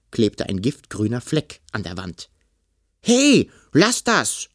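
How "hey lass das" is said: high, loud, slow outraged cry; indignant shout. Acted. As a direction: yell at it to stop. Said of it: "rief Kalli empört".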